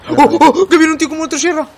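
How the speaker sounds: loud alarmed yelling; panicked shout. Real, not acted. Chainsaw enemy charging at them.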